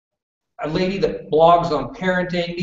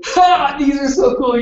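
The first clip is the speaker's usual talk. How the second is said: high, louder burst of enthusiasm; real geeky excitement, pitched way up; excited delight; 10 semitones higher, 4 dB louder than usual. Real, not acted. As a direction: gush over a favourite gadget. Delighted answer about short links.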